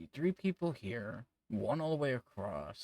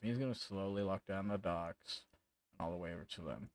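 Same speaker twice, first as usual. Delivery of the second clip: ordinary talk, nothing marked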